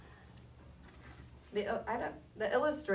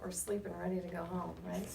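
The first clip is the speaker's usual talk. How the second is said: ordinary delivery, nothing marked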